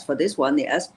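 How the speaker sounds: ordinary delivery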